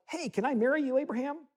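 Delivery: lightly voiced eager bride; mock Hagar. Imitates Hagar asking Abraham.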